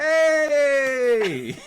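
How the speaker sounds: high, loud gleeful cheer into a laugh; triumphant laughing shout. Real, not acted. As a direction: crow with delight at your own trick. Triumphant cheer after story.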